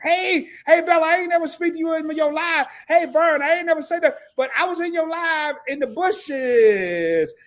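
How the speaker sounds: put-on voice of an entitled latecomer; imitating pushy viewer. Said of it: "your happy ass hop in there talking about".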